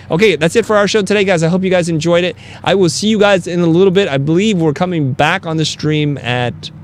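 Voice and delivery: loud upbeat show sign-off; host outro. Acted. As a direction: wrap the show with big energy.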